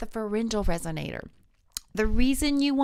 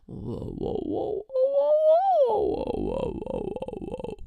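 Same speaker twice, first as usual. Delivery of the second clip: whoa siren; slower than usual. Shown as a demonstration. Voice teacher leading a whoa slide warm-up. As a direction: sweep down a big range on each whoa. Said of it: "Do it again".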